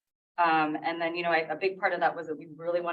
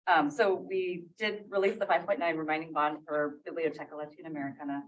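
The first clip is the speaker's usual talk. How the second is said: ordinary talk, nothing marked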